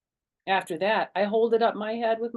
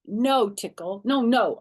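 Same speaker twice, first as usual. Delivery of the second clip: louder, higher no no no; child scolding; 5 semitones higher, 8 dB louder, slower than usual. A narrator's reading. Girl scolds Tickle chewing her doll, loud. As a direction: scold the dog sharply. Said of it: "I said".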